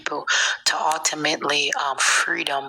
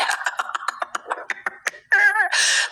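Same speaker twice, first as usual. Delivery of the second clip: high laugh. Real, not acted. Laughs about her husband.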